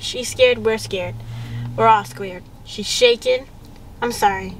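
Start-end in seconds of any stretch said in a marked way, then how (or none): none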